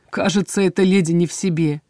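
ordinary delivery

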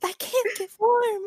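put-on creepy ghost girl. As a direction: wail it like a freezing spirit. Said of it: "a creepy girl named Mimi".

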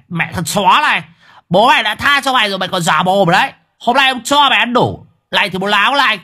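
high, loud fast threats; enraged thug. Acted. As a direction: lay into him again. Thug resumes the beating, enraged. (giọng côn đồ điên tiết)

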